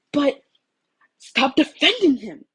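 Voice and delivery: loud, high, slow outburst; frustrated shout; yelled. Acted. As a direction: yell it out at him. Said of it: "Shoto yelled".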